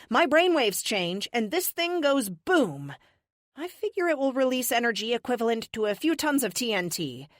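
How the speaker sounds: high, emphatic warning, key words stretched; defiant captive, threatening. Acted. Captive warning the witch off her powers, cocky threat. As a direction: deliver a showy threat to the captors.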